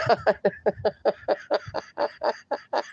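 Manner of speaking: soft laugh dying away; helpless, trailing quiet laughter; real laughter. Real, not acted. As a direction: laugh quietly until out of steam. Overcome by funny chat.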